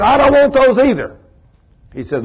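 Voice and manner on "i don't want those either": higher-pitched woman's protest; imitating an exasperated refusal; retold upset refusal. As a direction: push the offer away, voice raised in pitch. Girl refuses the offered drugs.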